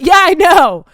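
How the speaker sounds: loud, high, cocky "yeah, I know"; smug agreement. Retold or imitated. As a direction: play him smug and loud. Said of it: "he went".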